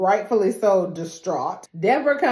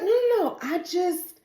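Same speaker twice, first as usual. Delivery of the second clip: high mock pleading; 9 semitones higher than usual. Ava pleading, acted high.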